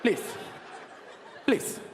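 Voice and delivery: put-on curt, accented "please" with long gaps; man of one word. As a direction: imitate a driver's terse, repeated request. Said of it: "his favorite word was".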